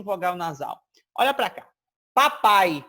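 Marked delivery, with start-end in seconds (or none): 2.1-2.8 PAPAI loud and open, all through the mouth; fully oral vowels. Shown as a demonstration. Teacher shows students an oral word, fully oral PAPAI. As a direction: say Papai with no air through the nose. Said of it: "Papai saiu totalmente pela boca".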